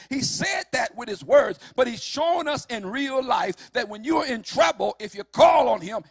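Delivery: loud rising preacher's shout; shouted preaching. Acted. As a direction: drive it home to the congregation.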